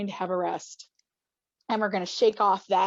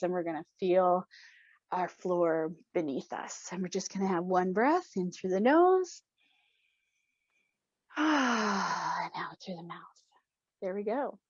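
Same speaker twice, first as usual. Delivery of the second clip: guided breathing; 5 semitones lower, 7 dB quieter than usual. Acted. Leader slows the group into a deep breath, soft and calm. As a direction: ease them into the breath.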